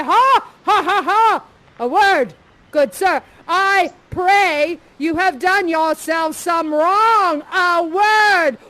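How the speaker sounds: loud mocking laugh then slow loud challenge; booming stern father. Parent interrupting the lovers, loud and mocking. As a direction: boom it like an outraged parent. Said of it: "And I say".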